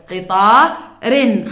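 قطار رن said loud and slow; loud tanween demo. Shown as a demonstration. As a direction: call out قطار رن for the class. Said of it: "أو بصير نقول قطار". (تنوين بصوت عالٍ)

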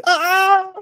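high scared scream of the crowd. A narrator's reading. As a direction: shriek in fright. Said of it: "The townspeople all screamed".

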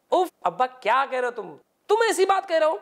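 rising, exasperated back-talk to a father; imitating a son's indignant complaint; mock indignant protest. Son protesting to his father.

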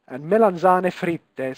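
melanzane fritte said slowly as may-lan-TSAH-neh FREE-teh, rolled and clear; slow Italian pronunciation. Shown as a demonstration. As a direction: spell out "melanzane fritte" slowly in Italian, every vowel open. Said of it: "who wants to pronounce that?".

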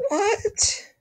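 put-on very high squeaky girl voice; high cute anime girl.

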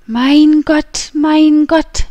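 high, loud, drawn-out exclamation; distressed cry. A narrator's reading. Wife alone after closing the door on husband, crying out. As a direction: cry out in despair, holding the words. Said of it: "rief Constance".

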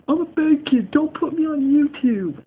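high, loud mock kid voice; mocking the bad kid. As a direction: whine it like a bratty kid, mockingly. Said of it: "then he's probably saying".

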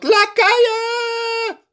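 very high, loud shriek. A narrator's reading. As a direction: princess screams for her servants. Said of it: "ze gilt". (hoge gil)

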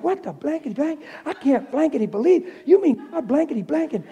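cursing grandma. Retold or imitated. The healed grandmother curses in disbelief, a lightly voiced mock rant. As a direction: ham up a grandma swearing in disbelief. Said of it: "ripping and tearing with word".

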